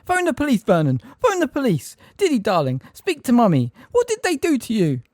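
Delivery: high, loud panicked scream. A narrator's reading. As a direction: shriek at husband and son. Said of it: "Aunt Petunia screamed".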